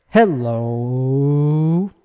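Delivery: "Hello, hello" sung with a big swooping pitch; swooping melody hello. Shown as a demonstration. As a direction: sing "hello" like a little melody. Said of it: "I just sang a fucking melody".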